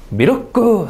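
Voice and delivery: "미르크" in three beats, Japanese style; three-syllable milk. Shown as a demonstration. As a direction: stretch milk into "미르크" with added vowels. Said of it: "삼음절 돼요".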